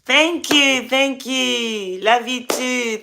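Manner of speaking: ordinary talk, nothing marked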